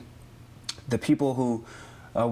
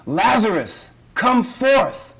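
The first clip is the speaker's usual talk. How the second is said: high, loud call, rising in force; commanding yell; 9 semitones higher, 7 dB louder, slower than usual. Acted. Jesus calling dead Lazarus out of the cave, commanding yell. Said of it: "he yells out and he says".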